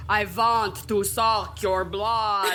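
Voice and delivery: slow, drawn-out practice vampire voice; stretched-out Eastern European vampire impression; titular vampire. Shown as a demonstration. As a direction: rehearse a hammy vampire line, dragging every word. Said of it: "titular vampire yet".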